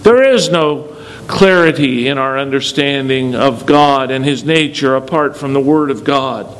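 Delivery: ordinary delivery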